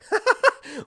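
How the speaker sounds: high, loud cackling laugh. Real, not acted. Teller relishing Cersei's regret, real laughing. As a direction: burst out laughing before the punchline.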